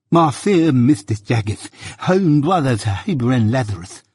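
fawning, every s turned to th; lisping suitor. A narrator's reading. Suitor begging Mister Jaggers, fawning with a lisp.

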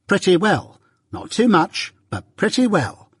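curt sister, voice pitched high; a short, high-pitched retort. A narrator's reading. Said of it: "returned my sister curtly".

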